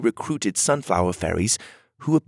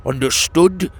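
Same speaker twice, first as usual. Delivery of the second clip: high, loud, demanding UNDERSTOOD with a rising tone; stern commander snapping the question "Understood?"; 8 semitones higher, 6 dB louder than usual. A narrator's reading. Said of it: "the Toad's voice grew stern".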